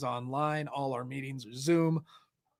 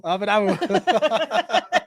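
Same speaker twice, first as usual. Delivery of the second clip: loud, high, drawn-out laughing echo; real laughing outburst; 6 semitones higher, 10 dB louder, much slower than usual. Real, not acted. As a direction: crack up while repeating the tease. Cracks up at teasing about accent.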